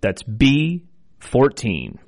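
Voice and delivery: slow, drawn-out number calling; bingo caller. Acted. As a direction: call each number clearly, then repeat. I will be your bingo caller.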